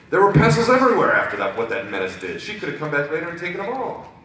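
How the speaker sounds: high, loud accusing outburst; outraged. Acted. Journalist blaming Rainbow Dash for pencils, outraged. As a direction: pitch up in mock indignation.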